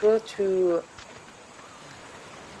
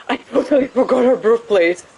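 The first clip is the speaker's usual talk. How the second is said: laughing; 3 semitones higher, 5 dB louder than usual. Real, not acted. Embarrassed at forgetting.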